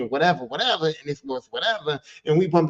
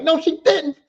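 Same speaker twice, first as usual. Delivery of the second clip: shocked no she didn't; 10 semitones higher, 5 dB louder, slower than usual. Retold or imitated. Outraged reaction in story.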